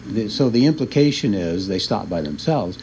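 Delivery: usual speaking voice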